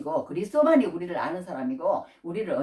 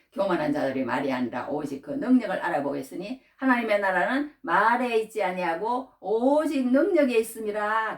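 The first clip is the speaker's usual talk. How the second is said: solemn scripture; 4 semitones higher, 4 dB louder than usual. Acted. Preacher proclaiming scripture to the congregation. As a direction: declare the verse with weight.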